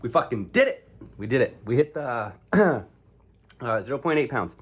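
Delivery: loud triumphant shout; excited cheer. Real, not acted. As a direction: celebrate hitting the goal. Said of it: "hit weight goal, celebrating".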